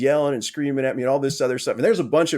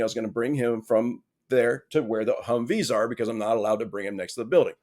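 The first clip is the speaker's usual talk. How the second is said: ordinary delivery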